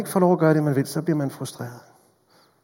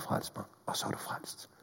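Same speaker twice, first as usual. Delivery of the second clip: whisper; 14 dB quieter than usual. Acted. Intimate whispered assurance.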